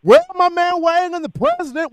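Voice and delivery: fired-up welcome; excited greeting. Real, not acted. Host greets returning caller.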